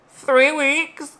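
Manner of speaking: put-on high, loud teen-drama outburst; Cassie from Euphoria. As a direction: blurt it out high-pitched, like an overwrought TV character. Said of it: "is that Cassie from Euphoria?".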